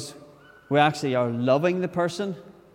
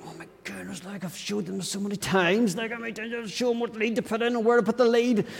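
higher, much softer exasperated inner voice; inner exasperation; 7 semitones higher, 3 dB quieter than usual. Retold or imitated. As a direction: think it through clenched patience. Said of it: "internally you're thinking".

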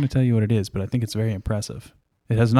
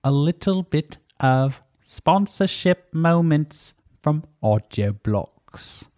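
slow put-on ad accent; special Audio Blocks accent; 5 semitones higher, slower than usual. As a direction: drawl it like a silly ad voice. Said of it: "What accent was that?".

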